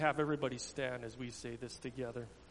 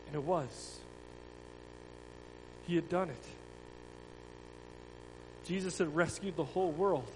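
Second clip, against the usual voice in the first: a dramatic storyteller's read; children's story reading; 6 semitones higher, 7 dB louder than usual. Acted. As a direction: tell it to the children with feeling. Tells the children's Passion story.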